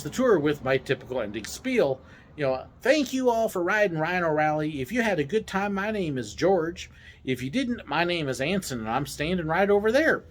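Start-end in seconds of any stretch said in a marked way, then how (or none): 2.8-10.2 higher, put-on Texas drawl. Driver's ending spiel at unload, hammed-up Texas drawl. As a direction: sing out a tour sign-off in mock Texan. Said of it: "this terrible Texas accent".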